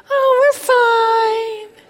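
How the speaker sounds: high, slow, sing-song fake cheer; fake cheerful. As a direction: put on a bright, phony church smile. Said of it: "think that you're a hypocrite".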